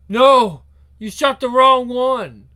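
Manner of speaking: high, loud cry of horror; horrified, drawn-out yell; anguished shout. Acted. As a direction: cry out at a terrible loss. Father sees wrong daughter shot.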